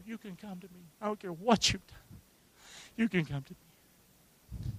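very soft, gentle invitation; tender reassurance. Acted. Parent reassuring a child who has done wrong, tender hush. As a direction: offer it softly, almost under the breath.

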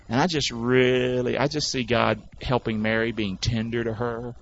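usual speaking voice

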